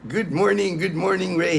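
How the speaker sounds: ordinary delivery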